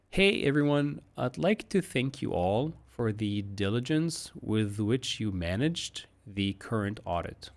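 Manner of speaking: slow, careful model read. Shown as a demonstration. Teacher slowing down the model sentence for students, slow and careful. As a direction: take it slowly, word by word. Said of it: "but much slower".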